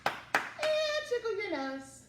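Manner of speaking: high, slow, squeaky tickle line. Acted. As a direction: squeak it out playfully. Rhyme line, pitched up high.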